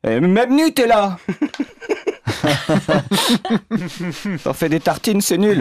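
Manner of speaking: voix de femme aiguë, un peu plus forte. Retold or imitated. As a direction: imiter l'épouse d'une voix perchée. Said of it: "elle me dit". (voix d'épouse aiguë)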